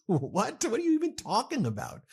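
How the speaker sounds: high-pitched, laughing disbelief; incredulous. Acted. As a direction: react in baffled, scoffing disbelief. Mock disbelief at quoted tariff claim.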